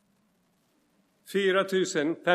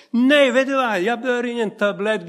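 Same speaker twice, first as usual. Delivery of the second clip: louder and higher, bragging about the healing; showy loud refusal; 6 semitones higher, 6 dB louder than usual. Pretend. The way he did not answer the sister, loud and boastful. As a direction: make a big triumphant show of "jag behöver ingen tablett". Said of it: "jag sa inte till henne".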